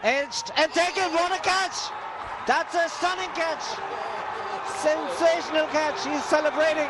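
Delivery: excited commentary. Real, not acted. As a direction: call the catch in sheer delight. Wicket falls, commentator erupts.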